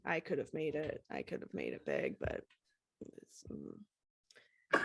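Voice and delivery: low, soft grumble with a squint; lightly voiced grumpy rocker. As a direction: mutter it like a bitter has-been.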